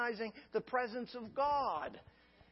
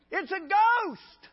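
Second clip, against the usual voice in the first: high, loud, slow terrified wail; terrified cry; 11 semitones higher, 9 dB louder, much slower than usual. Acted. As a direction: cry it out like frightened disciples. Disciples' terrified cry acted.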